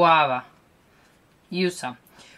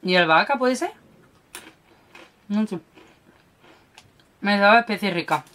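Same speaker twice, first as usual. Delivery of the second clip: speaking between bites; talking while chewing; faster than usual. Real, not acted. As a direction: mouth partly full. Seen 0: Bites stick snack and chews while talking, from before until ~8.7s.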